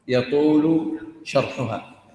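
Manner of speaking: يطول شرحها drawn out slowly and heavily; slow right down on يطول شرحها; slow repeat. Shown as a demonstration. Teacher slowly repeating يطول شرحها to his class.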